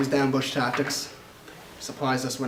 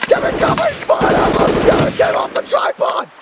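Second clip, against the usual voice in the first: screaming battlefield commands, far higher; cover me shout; 15 dB louder, slower than usual. Acted. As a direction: yell over gunfire in a firefight. Battle flashback, calling for fire.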